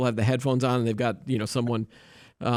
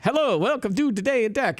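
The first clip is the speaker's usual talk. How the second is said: higher, hammed-up cartoon Italian Mario accent; bad Mario accent; 8 semitones higher than usual. Host mocking a bad Italian dub, hammy Mario Italian accent. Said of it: "me doing a bad Mario accent".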